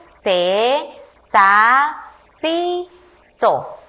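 put-on slow, separate syllables read aloud for children; syllable drill. Shown as a demonstration. Teacher reading the S syllables to young pupils. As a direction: say each syllable on its own. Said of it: "Observemos qué vocal acompaña la S".

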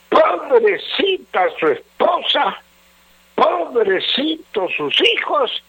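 high-pitched pitying lament; emphatic pity. Real, not acted. Adviser pitying a drinker's wife and children, high and lamenting. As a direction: pity them in a high, swooping lilt.

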